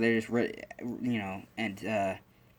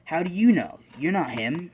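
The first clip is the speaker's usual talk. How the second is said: much higher and louder, sharp retort; defensive Bakugo; 6 semitones higher, 5 dB louder than usual. Retold or imitated. Bakugo angrily defends Izuku against a classmate, loud and sharp. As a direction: snap back at the bully.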